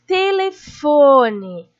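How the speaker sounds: "Telefone" very slowly, FO long and loud; stress on FO. Shown as a demonstration. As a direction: call out "Telefone", stretching and punching the third syllable FO. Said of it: "Foi a sílaba que eu mais demorei pra falar".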